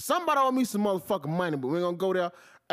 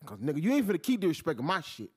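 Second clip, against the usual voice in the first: usual speaking voice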